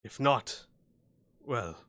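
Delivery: lightly voiced sly hobgoblin, slow and menacing; a hint of a gloating goblin chief. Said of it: "the hobgoblin says".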